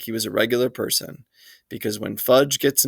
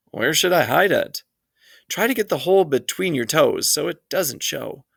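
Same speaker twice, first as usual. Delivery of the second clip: raised-pitch worried mother, fretting; a fussy mom fretting over a sock; lightly voiced anxious mother; fussing mother; 6 semitones higher than usual.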